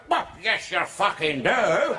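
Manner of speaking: slow, drawn-out sarcastic comeback; deadpan retort. Retold or imitated. Comic's imagined slow, smug comeback to Annika Rice. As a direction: lean on it slowly and smugly. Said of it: "his imagined reply".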